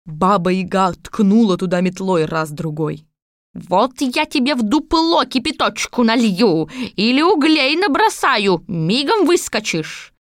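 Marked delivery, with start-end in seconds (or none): 3.5-10.1 put-on threatening old witch. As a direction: menace him like an old witch. Baba Yaga threatens. (грозная старуха)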